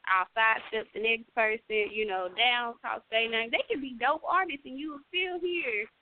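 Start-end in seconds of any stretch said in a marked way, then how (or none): none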